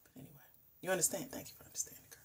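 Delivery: soft unvoiced whisper to the viewers; real anxious whisper. Real, not acted. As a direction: keep it hushed and shaken. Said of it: "I am really freaking out".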